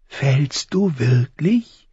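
high, slow crow question; high, thin voice. A narrator's reading. Crow questions the boy back, high and thin. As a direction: turn it back slowly, thin and rising. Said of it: "Die Stimme klang hoch und dünn".

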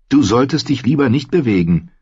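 leicht gespielte, höhere Jungenstimme; besorgter Junge. A narrator's reading. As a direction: mahn den Freund, etwas höher. Besorgter Freund am Krankenbett. (besorgte Jungenstimme, leicht angedeutet)

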